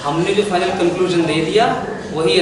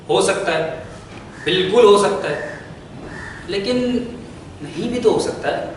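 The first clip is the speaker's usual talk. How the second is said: ordinary talk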